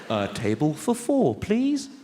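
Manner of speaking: patient tape voice; disc translation. Retold or imitated. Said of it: "She says".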